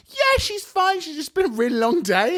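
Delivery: breezy cover-up. Retold or imitated. Covering up for sick friend.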